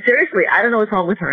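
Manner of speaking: a husband protesting that he's clueless; baffled husband. Retold or imitated. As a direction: rush it out, bewildered.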